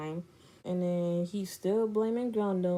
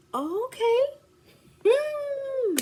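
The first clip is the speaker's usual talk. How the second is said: very high, squeaky okay; reply in a strained high pitch; mock-polite disbelief; much slower than usual. She answers friend's taunt, high-pitched disbelief.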